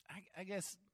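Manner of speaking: ordinary talk, nothing marked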